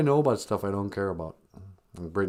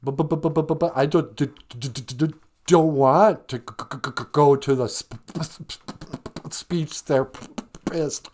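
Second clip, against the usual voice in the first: rapid-fire stuck consonants, raised pitch; exaggerated stutter; 5 semitones higher, 4 dB louder than usual. Boy objecting to speech therapy, exaggerated stutter. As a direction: stutter hard on every first sound. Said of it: "I was a stutterer".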